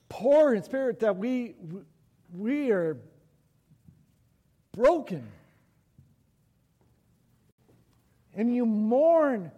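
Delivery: fervent preacher's appeal with long pauses; impassioned preaching. Real, not acted. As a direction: press it on the congregation, high and urgent. Preaches at full force.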